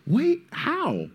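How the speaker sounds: high put-on disbelief; baffled surprise. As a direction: sound floored and confused. Disbelief at wrestlers' praise.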